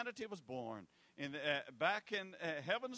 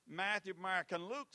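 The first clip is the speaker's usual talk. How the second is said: usual speaking voice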